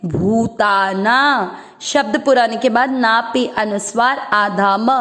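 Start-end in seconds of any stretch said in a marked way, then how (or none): 0.1-1.6 भूताना with the भू drawn out long; भूताना with a long held u. Shown as a demonstration. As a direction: stretch the ऊ of भू slowly. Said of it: "भू की मात्रा बड़ी लंबा खींचेंगे".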